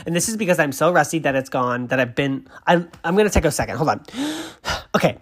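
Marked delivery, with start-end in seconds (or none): 4.1-4.9 excited gasp. Real, not acted. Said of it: "I'm so excited".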